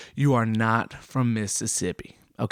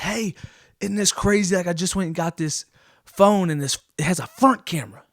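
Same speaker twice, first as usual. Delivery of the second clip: much higher, eager, showing off; excited younger self; 7 semitones higher than usual. Retold or imitated. Teen showing a relative his new front camera, excited and high. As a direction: gush about the new phone. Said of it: "and I said".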